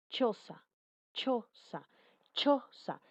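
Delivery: "choza" punched out word by word, the "ch" stopped then released; affricate demonstration. Shown as a demonstration. Teacher showing how che expels air, emphatic demonstration of "choza". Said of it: "impide el paso del aire y luego lo expulsa".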